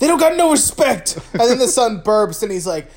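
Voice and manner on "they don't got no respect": high, put-on indignant voice; mock complaining dad. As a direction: grumble like a fed-up dad, hammed up. Continues the grumbling dad's quote.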